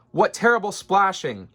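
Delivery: high, loud dismayed cry; frowning complaint. A narrator's reading. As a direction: scold the splashing. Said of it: "the elephant frowned".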